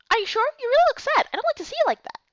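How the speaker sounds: a high, worried friend voice; concerned friend. Acted. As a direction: press gently but insistently. Character voice in story.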